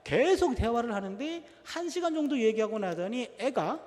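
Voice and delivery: ordinary talk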